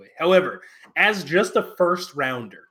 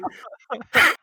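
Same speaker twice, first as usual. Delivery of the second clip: breathy wheeze of a laugh; high, airy wheezing giggle; 9 semitones higher, 4 dB louder than usual. Real, not acted. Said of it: "It's me, it's me wheezing laugh".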